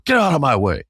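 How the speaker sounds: high-pitched retold defiance; angry brush-off. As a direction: push past someone, acting fed up. Storms past coach, acted quarrel.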